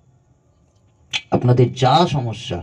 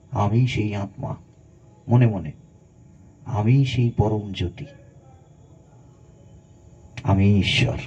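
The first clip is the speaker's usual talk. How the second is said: calm affirmation; 3 dB quieter than usual. Shown as a demonstration. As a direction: say it inwardly, serene. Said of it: "প্রত্যেক দিন ঘুম থেকে উঠে বলুন".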